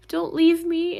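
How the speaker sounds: put-on high, tiny pleading voice of a baby chihuahua; pleading little chihuahua. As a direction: beg like a small scared puppy. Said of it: "she's like".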